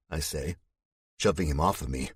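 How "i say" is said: usual speaking voice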